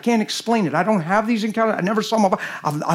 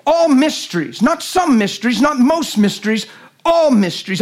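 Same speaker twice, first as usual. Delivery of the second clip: "all" set hard against "some" and "most"; stress on all; 4 semitones higher than usual. Real, not acted. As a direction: punch the word "all" each time.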